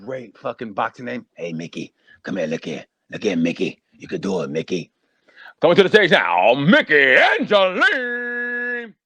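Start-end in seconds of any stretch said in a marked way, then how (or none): 1.3-4.9 low, gruff corner-man pep talk; lowered coach voice. Retold or imitated. Trainer coaching his fighter in the corner, low and gruff. 5.6-8.9 drawn-out, booming ring announcer call. Pretend. Introducing a boxer to the crowd, long booming announcer call.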